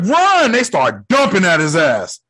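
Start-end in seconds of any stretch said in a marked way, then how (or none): 0.0-0.5 high, urgent shout; mock urgent yell. Hogan warning Parker before they open fire, urgent yell. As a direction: call out a warning to flee.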